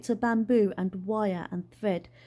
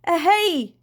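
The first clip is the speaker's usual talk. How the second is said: high, loud hailing shout; yell; 7 semitones higher, 7 dB louder than usual. A narrator's reading. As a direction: yell to get someone's attention. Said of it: "he yelled".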